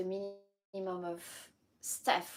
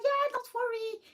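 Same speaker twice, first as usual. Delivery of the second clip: mimicking the neighbor; 4 dB louder than usual. Voicing the neighbor's reply.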